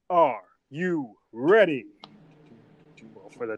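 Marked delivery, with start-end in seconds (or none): 0.1-1.9 slow, loud, drawn-out ring announcer; drawn-out announcer call. Acted. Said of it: "I said".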